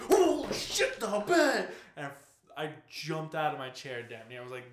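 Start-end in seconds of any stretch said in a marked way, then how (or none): 0.0-1.7 high, loud panicked yell; retold jump-scare scream; startled shout.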